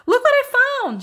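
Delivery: high, loud cry of triumph; triumphant shout. A narrator's reading. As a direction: shout it out like a prize-winner. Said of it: "she shouted tri-, triumphantly".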